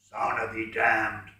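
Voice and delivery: low, slow, cursing voice; swearing. A narrator's reading. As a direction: spit out the curse. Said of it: "swore the voice".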